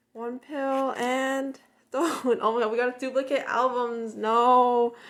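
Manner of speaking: loud, half-laughing wail of dismay; playful loud anguish over a duplicate; laughing mock despair. Real, not acted. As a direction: moan it like a tragedy while laughing. Finds a duplicate album, mock despair.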